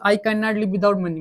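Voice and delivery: ordinary talk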